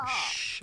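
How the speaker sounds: soft shush; one quick "shh"; hushing. Real, not acted. Hushing kids while showing merch.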